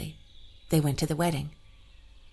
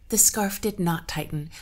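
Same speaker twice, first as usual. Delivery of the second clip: ordinary delivery, nothing marked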